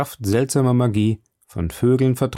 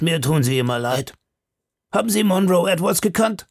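higher, chatty and confiding; gossiping grub-man; 6 semitones higher than usual. Acted. Grub-man to the lawyer, talking forgers, higher and confiding. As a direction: lean in and share prison gossip.

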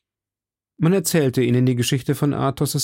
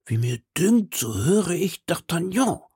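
hollow voice from the cellar, pitched up; muffled voice; 6 semitones higher than usual. A narrator's reading. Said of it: "mit dumpfer Stimme".

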